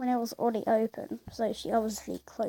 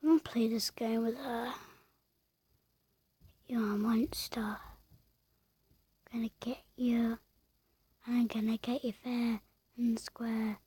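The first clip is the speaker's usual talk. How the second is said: soft unvoiced whisper; sneaky hushed threat; whispered plotting; 5 dB quieter than usual. Real, not acted. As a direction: scheme in a whisper. Secret plotting aside.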